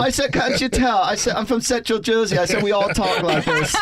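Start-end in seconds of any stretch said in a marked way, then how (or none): 0.3-1.0 put-on playful English accent, much higher. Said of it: "with an English accent". 1.4-2.4 put-on playful English accent, much higher. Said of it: "with an English accent". 2.6-3.8 put-on English accent breaking into a laugh. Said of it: "with an English accent".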